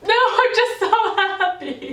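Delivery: very high, squeaky mock-tearful wail; hammed-up happy sobbing, half laughing; imitated ugly crying. Tearful woman reassuring worried guests at the wedding, mock crying. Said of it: "full on ugly crying, guys".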